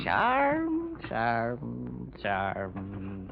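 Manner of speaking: put-on drowsy drunken mumble. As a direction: drift off to sleep mid-word. Drunk man dozing off in bed.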